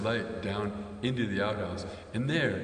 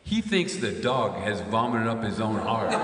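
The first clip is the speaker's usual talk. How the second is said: ordinary talk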